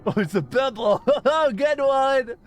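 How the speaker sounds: high giggly voice through laughter; laughing, mock infant. Real, not acted. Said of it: "I'm like an infant".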